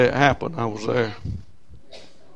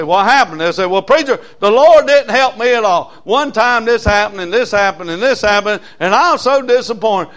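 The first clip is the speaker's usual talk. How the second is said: loud, higher whiny backslider; complaining churchgoer; 7 semitones higher, 10 dB louder than usual. Retold or imitated. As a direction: whine the excuses to the preacher.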